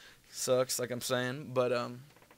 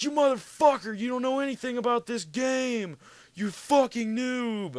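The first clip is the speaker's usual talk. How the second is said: imitating an angry rant; 12 semitones higher, 7 dB louder than usual. Friend raging at the guy who ruined his gunship round, high mock fury. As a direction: scream it like a furious teammate. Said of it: "and he was pissed off".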